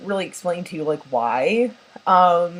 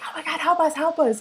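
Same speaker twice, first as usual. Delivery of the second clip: mock panic; 10 semitones higher than usual. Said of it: "They're like".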